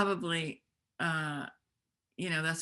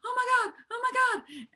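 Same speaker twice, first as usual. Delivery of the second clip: much higher and louder, a breathless panicked squeal; frantic excited gush; 5 dB louder than usual. Retold or imitated. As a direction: gush it like an overeager young newcomer. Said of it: "I'd be like".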